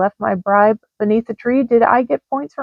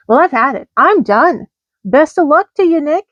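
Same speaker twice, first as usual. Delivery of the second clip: rising to a higher, louder kiss-off; fed-up quitting; 5 semitones higher, 4 dB louder than usual. Acted. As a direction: storm out of the job, building heat. Vixen quits in anger.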